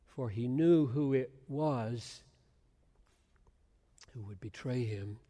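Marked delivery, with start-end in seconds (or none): none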